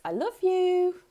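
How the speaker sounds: high, breezy, tossed-off "I love you"; flippant sing-song. Shown as a demonstration. A parent tossing the words off on the way out, breezy and high. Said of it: "just flip out the three words".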